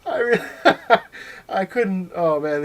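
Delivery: real high, loud laughing fit; words breaking up in helpless laughter; cracking up. Real, not acted. Cracks up retelling his joke.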